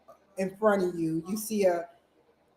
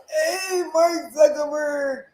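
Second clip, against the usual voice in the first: excited greeting; 8 semitones higher, 6 dB louder, slower than usual. Pretend. Greeting Zuckerberg in future scene.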